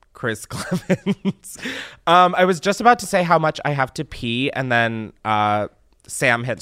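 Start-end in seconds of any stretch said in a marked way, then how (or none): 0.1-1.6 laughing. Real, not acted. Laughs at own intro.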